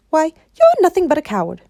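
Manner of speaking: loud high shocked scolding; girl shocked at a cowardly lion. Acted. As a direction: call him out in disbelief. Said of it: "Dorothy was shocked".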